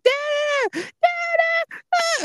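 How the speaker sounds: put-on very high, shrill child's cry of Dada; panicked little girl yelling for her daddy; toddler screaming for dad. Daughter calling dad from the bathroom, high panicked child cry. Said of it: "She's like".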